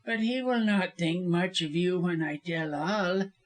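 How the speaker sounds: insinuating character voice; veiled threat. A narrator's reading. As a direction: warn her slyly. Lawyer threatens to tell.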